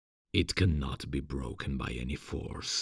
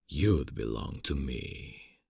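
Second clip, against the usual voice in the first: normal talking voice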